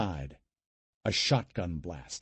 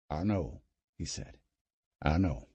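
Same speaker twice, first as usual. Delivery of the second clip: soothing; 5 semitones lower, 4 dB quieter than usual. Acted. Uncle comforting her.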